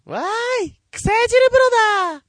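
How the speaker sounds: put-on very high, loud, beaming girl voice; high cute anime girl. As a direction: squeal it like a grinning anime heroine. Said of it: "原田ひとみが満面の笑みで一言。ドドン。". (満面の笑みのかわいいアニメ声)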